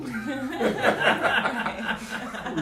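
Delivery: loud high laugh; real burst of laughter. Real, not acted. Speaker with Ray, cracking up out loud. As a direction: break into a big laugh. Laughing at own slow talk.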